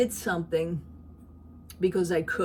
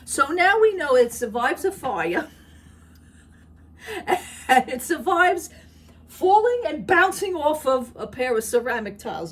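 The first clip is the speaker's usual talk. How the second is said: laughing; 7 semitones higher, 7 dB louder than usual. Real, not acted. Laughing teasing husband's cup.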